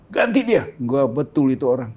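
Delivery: mock presidential demand; imitating the president. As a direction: bark the order like an irritated boss. President's order retold.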